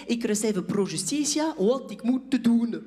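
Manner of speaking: slow, labored Dutch, word by word; getting stuck. Acted. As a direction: grope through a language you barely speak. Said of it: "je me retrouve un peu coincé".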